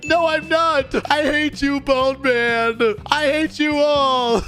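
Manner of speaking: high, whiny mock outburst; bratty tantrum. Bully refusing expulsion by the principal, throwing a tantrum. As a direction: protest like a kid throwing a fit.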